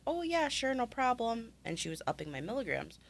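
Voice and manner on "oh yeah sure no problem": lightly voiced pharmacist, pitched higher; a higher voice for the agreeable pharmacist; imitating breezy pharmacist. Pharmacist agreeing to an early refill, lightly imitated in higher pitch. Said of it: "And she was like".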